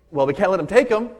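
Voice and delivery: excited Peter. Retold or imitated. Peter watches soldiers come to arrest Jesus, excited. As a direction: voice an eager Peter rallying for action. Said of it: "He was excited".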